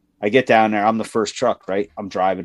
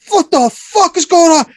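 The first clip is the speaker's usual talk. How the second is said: high, loud ranting buddy; fuming buddy; 5 dB louder than usual. Retold or imitated. His coworker raging about dispatch on the radio, high loud rant. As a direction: rant it out much higher and louder, fed up. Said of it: "He was fucking fuming".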